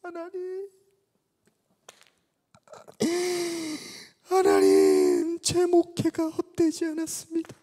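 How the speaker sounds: high, loud, slow crying prayer; tearful prayer. Retold or imitated. Old pastor weeping in prayer of thanks to God. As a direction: cry out to God through tears. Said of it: "울면서 막 기도를 하시죠".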